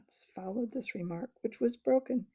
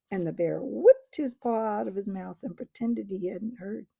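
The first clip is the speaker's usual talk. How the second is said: plain reading voice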